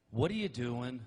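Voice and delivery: mock shocked wife asking; a wife's flat put-down question; woman's stern question. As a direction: deliver it like a wife stopping him cold. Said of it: "it goes just like this".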